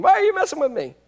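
high-pitched strained outburst; acted high cry of protest; possessed heckler. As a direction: break in suddenly like a demon-possessed churchgoer. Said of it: "And all of a sudden".

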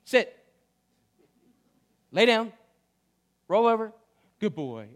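God giving dog commands to the congregation. Retold or imitated. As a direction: bark out dog commands then praise. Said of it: "God is telling you today".

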